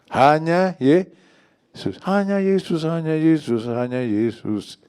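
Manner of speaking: loud, high preaching cry; impassioned proclamation. Real, not acted. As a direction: hammer the phrase out to the congregation. Preacher chants his central exhortation. (seruan khotbah yang lantang berulang-ulang)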